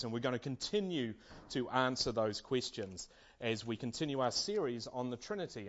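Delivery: normal talking voice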